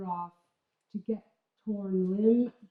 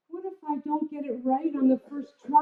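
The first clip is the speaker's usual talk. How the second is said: a mild worried plea, a bit higher; anxious plea; 7 semitones higher than usual. Retold or imitated. Pam fearfully asking her student before the kill, mild worry. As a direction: ask it with nervous doubt. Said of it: "I said".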